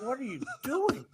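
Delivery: high-pitched exasperated question; retold exasperation. Investigator to client back on the phone, retold exasperation. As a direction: imitate an incredulous scolding over the phone.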